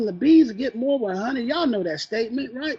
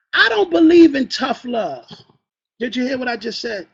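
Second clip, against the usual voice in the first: ordinary talk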